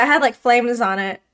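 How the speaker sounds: usual speaking voice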